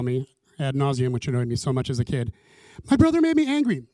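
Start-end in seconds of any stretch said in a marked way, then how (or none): 2.9-3.8 high, loud mock kid's complaint; indignant childish outburst, pitched way up; complaining child. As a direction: imitate a kid tattling on a brother. Herself as kid complaining to mom.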